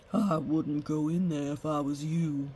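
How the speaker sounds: low, subdued blacksmith's caution; warning. A narrator's reading. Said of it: "warned the blacksmith".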